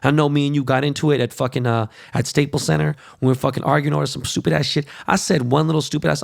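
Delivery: ordinary talk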